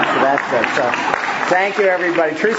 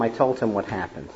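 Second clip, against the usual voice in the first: normal talking voice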